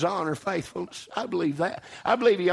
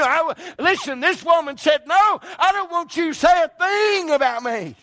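preaching at full force; 8 semitones higher than usual. Acted. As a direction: drive it home and shout the last line. Preacher rises mocking praise-seekers.

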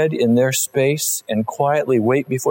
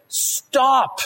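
sharp high-pitched cry of Stop; the prophet halting Saul mid-excuse; stern Samuel; 12 semitones higher than usual. Acted. Preacher voicing Samuel cutting Saul off, high and sharp.

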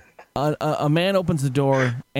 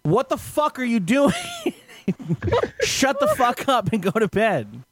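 mock scream; 9 semitones higher than usual. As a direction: imitate an irate man screaming out his door. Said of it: "screams out".

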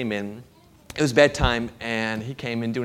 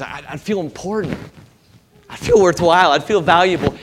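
louder, higher eager wanting; craving voice; 5 semitones higher, 9 dB louder than usual. Retold or imitated. Preacher voicing the congregation's craving for worth, louder and eager. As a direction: push the longing out hard.

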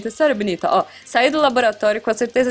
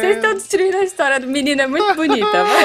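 laughing hard; 8 semitones higher, 3 dB louder than usual. Real, not acted. Host cracking up mid-story.

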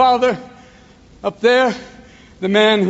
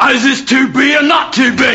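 raised, shouted tipsy poet; drunken Shakespeare; 5 semitones higher, 3 dB louder than usual. Acted. Poet knocking on doors after a couple of beers, drunken shout. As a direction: bellow like a drunk at the door. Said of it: "after a couple of beers".

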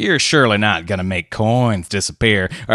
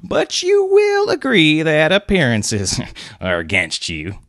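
polite; 9 semitones higher, 4 dB louder than usual. A narrator's reading. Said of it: "said Clovis politely".

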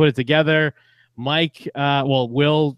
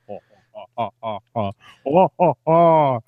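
spaced-out "oh oh oh" villain laugh; imitated monster laugh; 4 dB louder than usual. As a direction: mimic the monster's laugh, building louder. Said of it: "that's kinda like a".

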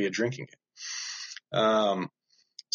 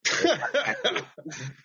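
laugh; 6 semitones higher than usual. Real, not acted. Reacting to guest's platen joke.

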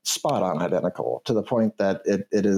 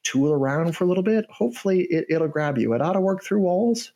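canary voice; 6 semitones higher than usual. Acted. Canary NPC explains sniffer.

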